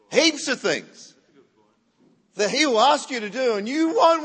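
loud, emphatic preacher delivery; forceful pulpit proclamation; full-force preaching. Real, not acted. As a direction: drive the point home to the congregation, loudly.

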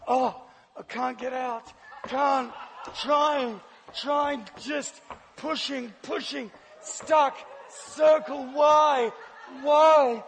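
slow, stretched mock struggle; hammed-up overacting. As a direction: overact being trapped, word by word. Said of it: "overacting to the max".

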